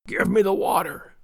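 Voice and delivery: strained sickbed gasp. A narrator's reading. Sick man asking for water, gasping. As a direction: beg weakly, short of breath. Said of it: "he gasped".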